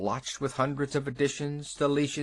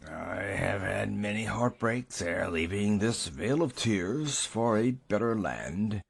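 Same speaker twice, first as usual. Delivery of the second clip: put-on solemn old man; 4 semitones lower than usual. A narrator's reading. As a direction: intone it like a dying patriarch. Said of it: "began to write and speak at the same time".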